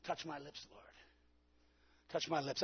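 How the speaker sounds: soft, pleading murmur to the Lord; hushed prayer. Shown as a demonstration. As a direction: breathe the prayer quietly and humbly. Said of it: "some of you ought to just say".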